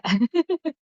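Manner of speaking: laughing through the word, voice jumping up. Real, not acted. Young woman laughing while sharing in prayer group. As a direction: giggle it out, amused at herself. Laughing about complaining to God.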